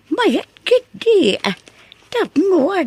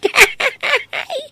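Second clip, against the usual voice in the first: high laugh; 9 semitones higher than usual. Acted. Grandmother laughs at the girl.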